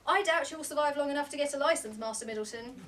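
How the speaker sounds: loud dismissive nanny. Acted. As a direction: wave him off with scorn. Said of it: "The nanny shrugged dismissively".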